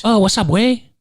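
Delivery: a brief cartoonish Mexican greeting; mock Mexican accent. Host blurting a quick joke Mexican accent. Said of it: "What is that Mexican accent?".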